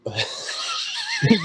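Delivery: sudden high burst of laughter; a laugh that jumps up high. Real, not acted. Laughs at the previous line.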